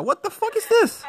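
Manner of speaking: sudden high-pitched what the fuck; jolted by something unexpected; startled outburst. Real, not acted. Streamer reacting to something on screen, startled and pitched high.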